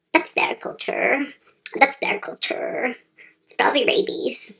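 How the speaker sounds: whispering. Acted. Unvoiced whispered aside.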